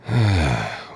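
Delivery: long, low exhale; weary sigh. Acted. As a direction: sigh with tired impatience. Waiting, bored.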